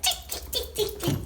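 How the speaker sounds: high, giggly monkey tick-ticking; monkey chatter. Acted. Monkeys tease the peddler back, high, laughing chatter. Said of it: "and said".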